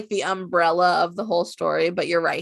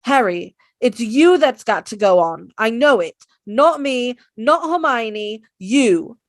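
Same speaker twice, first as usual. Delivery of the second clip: louder, earnest Ron urging Harry on; Ron impression; 5 semitones higher, 8 dB louder than usual. Ron urging Harry to go on, louder insistent. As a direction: push Harry forward, insistent. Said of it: "the next one is Ron".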